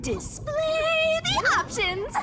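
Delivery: high, slow theatrical command; hammed-up order to display the options; grand command. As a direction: decree it like a showman summoning the menu.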